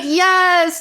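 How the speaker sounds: a high, loud burst of yes; excited yes. Retold or imitated. Coach cheering the client's breakthrough, excited yes.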